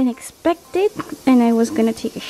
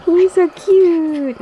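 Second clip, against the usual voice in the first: high, slow cooing; 7 semitones higher, 4 dB louder, much slower than usual. Real, not acted. Mom cooing at her smiling baby.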